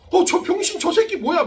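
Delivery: mocking classmates. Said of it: "막 이런 거야".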